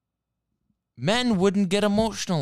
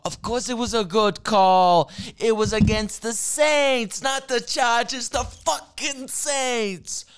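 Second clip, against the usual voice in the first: mock outrage; 7 semitones higher, 3 dB louder than usual. Ranting at Ted about the call.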